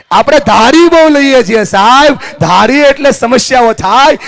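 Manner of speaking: high, loud motivational oratory; full-force preaching. Real, not acted. Speaker warning families about assuming, full-force preaching. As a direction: drive the point out to the whole hall.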